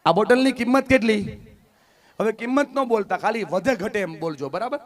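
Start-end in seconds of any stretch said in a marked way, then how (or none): 0.0-1.4 louder question. Shown as a demonstration. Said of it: "જોરથી બોલો ને ભાઈ".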